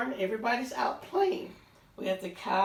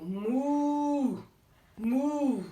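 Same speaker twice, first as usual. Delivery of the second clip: higher, drawn-out moo; mooing cow; 4 semitones higher, 4 dB louder than usual. Acted. Teacher mooing for the cows in the story, drawn out.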